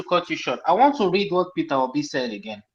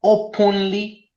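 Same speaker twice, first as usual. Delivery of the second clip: "openly" said slowly and deliberately; single out "openly", stretching each syllable; stress on openly; 4 semitones higher, much slower than usual. Real, not acted. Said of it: "I emphasize on the word openly".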